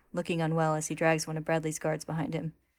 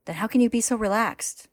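fretful; 6 semitones higher, 6 dB louder than usual. A narrator's reading. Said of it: "Al frets".

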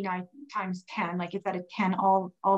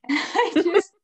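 high-pitched, through laughter; amused, raised-pitch retelling; laughing while telling; 8 semitones higher than usual. Real, not acted. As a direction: laugh as you recount your own meltdown. Laughs retelling her own breakdown.

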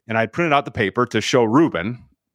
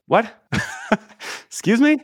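shocked disbelief; 13 semitones higher than usual. Retold or imitated. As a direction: voice leaps up high in disbelief. Stunned at the book offer.

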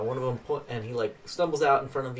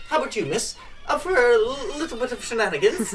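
much higher, louder chirpy little man's voice; tipsy little man; 11 semitones higher, 7 dB louder than usual. Acted. Thrown-out little drunk thanking the dwarf, high and loud. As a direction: play a cheerful drunk runt, pitched way up.

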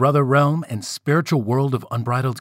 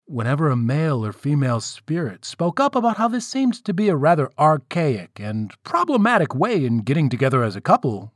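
plain reading voice

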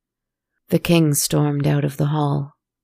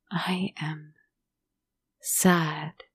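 tearful, softer whispered admission; sad whisper; 7 dB quieter, much slower than usual. A narrator's reading. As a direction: breathe it out in tears. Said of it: "tears fell".

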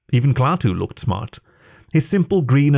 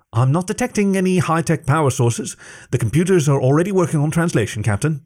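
a lightly higher, quick tech officer; eager computer officer; 6 semitones higher than usual. Acted. Computer officer eagerly reports to his captain, lightly higher. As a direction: rattle off the status eagerly.

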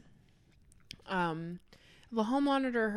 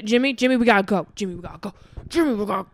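urgent calling; 6 dB louder, faster than usual. Acted. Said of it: "hall monitor was like".

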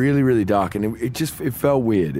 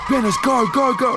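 very high, urgent squealed pleading; imitated panic. Bassist in the back begs manager to drive, mock panic. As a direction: act out a panicked passenger urging the driver on.

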